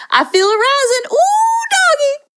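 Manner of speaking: hammed-up boiling over, pitch shooting up; play-acted rising anger. Parent acting out anger for the kids, hammed-up rising anger. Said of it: "So acting out these different examples".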